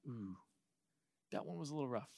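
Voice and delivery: a soft wince under the breath; wincing aside. Retold or imitated. A sibling wincing softly at a parent's harsh words. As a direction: murmur it like you're watching someone get scolded.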